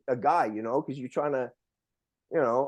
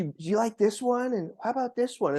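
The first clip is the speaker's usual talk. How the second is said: a lighter, slightly higher echo of her coy question; teasing girlfriend; 7 semitones higher than usual. Retold or imitated. Retelling date asking which underwear, mildly coy. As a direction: ask again coyly, less raised. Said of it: "so then she".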